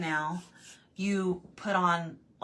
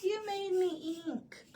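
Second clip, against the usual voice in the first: much higher, slow squeak; octopus voice; 12 semitones higher, 3 dB quieter, slower than usual. Retold or imitated. Quoting the octopus from Finding Nemo, high and slow. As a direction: squeak it like a startled little octopus. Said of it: "Finding Nemo".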